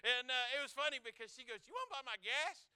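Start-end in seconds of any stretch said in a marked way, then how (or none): 1.7-2.7 higher, excited disbelief; lady thrilled her gas is paid, squeaking higher; voice up an octave. Retold or imitated. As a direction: let the voice jump up with delight. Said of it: "Her whole voice ... went up an octave".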